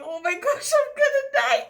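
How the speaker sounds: reliving the panic, cry out in alarm; panicked cry. Retold or imitated. Girl drowning at the beach, panicked high cry.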